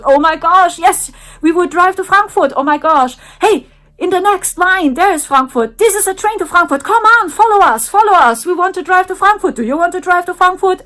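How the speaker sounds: high, loud and fast over-the-top excitement; mock excited youngsters. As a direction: gush about Frankfurt, high, loud and fast. Holograms excited about Frankfurt.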